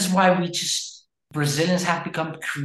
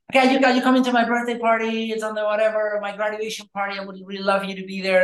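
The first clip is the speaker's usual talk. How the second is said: mock excited invite; 5 semitones higher than usual. Friend's invitation acted out.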